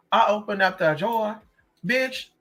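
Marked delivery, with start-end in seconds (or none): none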